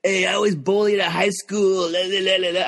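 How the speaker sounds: droning caricature of a whining comic; mocking hack comedian. Comic tired of every comedian's bullied story, mocking imitation. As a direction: imitate a tired routine, trailing into la-la nonsense. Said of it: "every comedian's just like".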